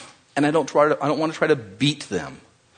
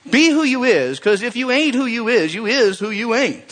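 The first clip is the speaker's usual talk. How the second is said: high but quicker repeat of the preacher line; a hint of the Southern preacher; lighter preacher echo; 7 semitones higher than usual. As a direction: say it again, less stretched. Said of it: "I get animated when I just hear this guy".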